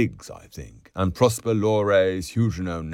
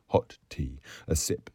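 ordinary delivery, nothing marked

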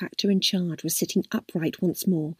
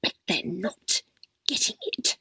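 spat; 5 dB quieter than usual. A narrator's reading. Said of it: "Vera spat".

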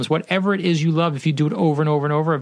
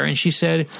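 usual speaking voice

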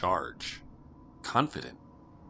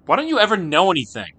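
put-on louder, higher, fast frustrated girl; exasperated outburst; 9 semitones higher, 10 dB louder, faster than usual. Sister loses patience with her brother, fast exasperated outburst. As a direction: blurt it out, fed up.

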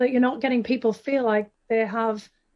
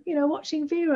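high, gushy quoted compliment; imitating a gushing admirer; 8 semitones higher than usual. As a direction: mimic someone cooing over an accent. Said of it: "somebody sort of said to me".

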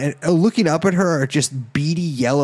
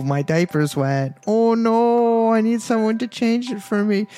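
put-on baby voice; 5 semitones higher than usual. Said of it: "I gotta work on my baby voice".

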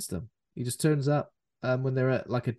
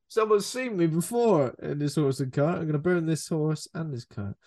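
mock Jesse Hooker; 5 semitones higher than usual. Jesse planning to burn the cart, higher put-on voice. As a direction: ham up a paranoid cowboy.

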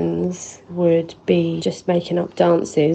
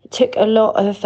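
usual speaking voice

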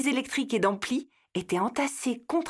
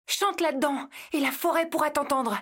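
put-on urging girl voice; 7 semitones higher than usual. Leila urges squirrel.

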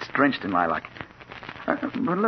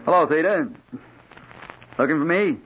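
feigned casual greeting, higher and louder; acting casual; 6 semitones higher, 4 dB louder than usual. Reporter greets a wanted girl across an air shaft, acting casual. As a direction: toss it out as if nothing is up. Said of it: "trying to act casual".

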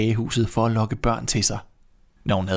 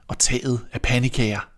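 usual speaking voice

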